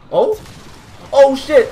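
loud high exclamation; loud surprised curse; startled shout. Real, not acted. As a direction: jump at the explosion. Grenade explodes.

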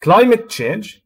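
climate punched high and loud; stress on climate. Shown as a demonstration. Teacher showing the class where the stress lands. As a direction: hit climate hard, let change fall away. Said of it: "فالستريس راح يكون على climate".

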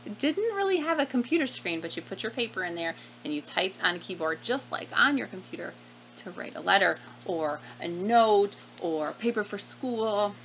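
plain reading voice

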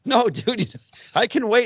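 polite protest. Retold or imitated. Politely declining the cage.

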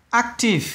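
"Active" with AC high, then dropping; first-syllable stress. Shown as a demonstration. Teacher shows students the stress, first syllable of "Active". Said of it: "âm đầu luôn".

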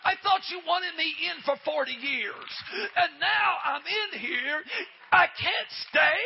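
high, loud tearful shout, slowing down; crying shout. Retold or imitated. Sister shouting from the baptistry that she cannot leave, tearful shout. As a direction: cry it out loud, overwhelmed.

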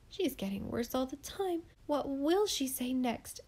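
ordinary delivery

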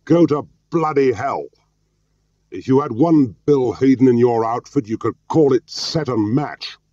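high, heated tirade; furious rant, pitched well up; furious shout. A narrator's reading. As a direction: keep shouting, angry and indignant. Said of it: "he shouted furiously".

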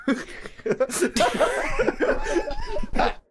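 high-pitched laugh. Acted. Laughs after making deal.

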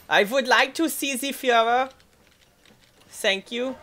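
high, hammed-up German accent; mock German accent. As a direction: make a formal request in caricature German accent. Plays a German visitor again.

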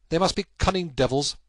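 exclaiming. Acted. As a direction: spit out the words in frustration. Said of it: "he exclaimed at last".

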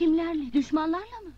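high incredulous question; can't believe it; surprised. Acted. Shocked question about enemies.

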